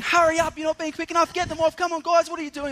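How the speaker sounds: high, loud drill-style hurrying; impatient yelling. Retold or imitated. Camp staffer yells at kids unloading bags, impatient and high. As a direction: yell at dawdling kids to move it. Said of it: "And the guy yelling".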